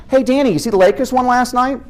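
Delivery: high, eager mom voice; a mother bursting to share the news; imitating his mom. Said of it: "She reads aloud every single newspaper article".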